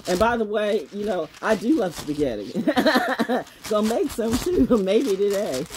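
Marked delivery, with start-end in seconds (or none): 2.5-3.4 short higher chuckle; brief burst of giggling. Real, not acted. Streamer amused by her spaghetti joke, chuckling.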